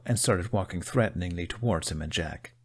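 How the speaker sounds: ordinary delivery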